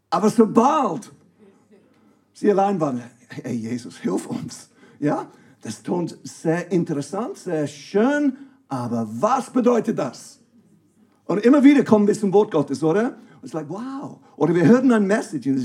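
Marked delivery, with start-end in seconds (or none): 3.3-4.7 hushed, unvoiced whisper asking for help; a mock-confused disciple whispering to Jesus; whispered plea. Disciples alone, secretly asking. 13.7-14.2 mock wow. Mock reaction to God's word.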